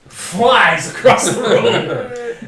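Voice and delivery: laughing loudly through the words; giggling while telling it. Real, not acted.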